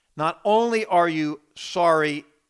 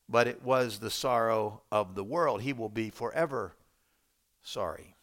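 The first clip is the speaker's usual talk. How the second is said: quiet and heavy; 5 semitones lower, 5 dB quieter than usual. Real, not acted. Preacher to his congregation about the world's sorrow, low and fading. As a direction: lower and softer, sinking to a hush.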